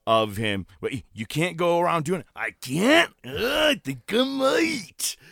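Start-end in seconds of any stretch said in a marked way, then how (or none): 2.3-5.2 high, slow, drawn-out defiance; defiant Macho Man. Retold or imitated. Macho Man defying the suit, high and slow. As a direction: drawl it like a smug wrestler. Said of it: "retelling Macho's defiant reply".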